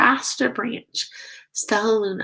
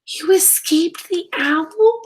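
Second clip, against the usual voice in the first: higher, louder, full of joyful disbelief; excited cry; 10 semitones higher, 5 dB louder than usual. A narrator's reading. Stella Luna cries out to her mother, overjoyed. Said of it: "cried Stella Luna".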